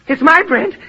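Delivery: possessive outburst. Acted. Starving man guards his bread from the woman, fierce. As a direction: snap it out like he'd fight for it.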